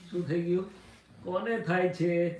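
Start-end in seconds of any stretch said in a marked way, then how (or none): 1.2-2.3 higher, louder and very slow; Dada Bhagwan's question. Retold or imitated. Guru asking a disciple who is troubled, slow and pointed. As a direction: stretch કોને out as a pointed question. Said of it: "દાદાનું આટલું જ બોલે".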